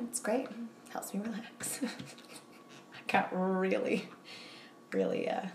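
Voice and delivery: bashful hushed admission; embarrassed whisper. Real, not acted. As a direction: drop to a whisper, shy about oversharing. Shy confession, trailing off.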